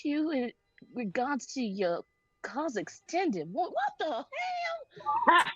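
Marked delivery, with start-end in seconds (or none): 3.5-5.5 high, slow shocked squeal with a laugh. Acted. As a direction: shriek it out in disbelief. Shocked reading fine print.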